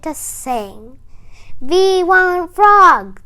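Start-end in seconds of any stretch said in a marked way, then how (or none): 1.6-3.2 loud, slow chant for Frog; crowd yelling. A narrator's reading. Crowd calls for Frog to sing, loud slow chant. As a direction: call out to the frog like a cheering crowd. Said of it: "the crowd yelled".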